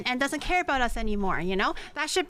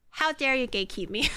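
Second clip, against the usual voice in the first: ordinary talk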